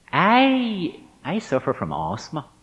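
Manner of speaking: high, drawn-out "I", then dropping away; big introduction, small confession. Retold or imitated. Student finally confessing to his teacher, grand build-up falling away. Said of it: "you know, a big introduction".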